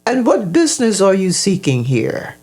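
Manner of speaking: put-on old man questioning. A narrator's reading. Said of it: "he spoke to Robbie".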